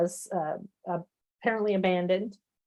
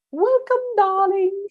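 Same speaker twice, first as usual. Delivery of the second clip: gushing hello, much higher and louder; bright, delighted welcome; 13 semitones higher, 6 dB louder, slower than usual. Real, not acted. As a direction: welcome sung out high and loud. Host greets guest warmly.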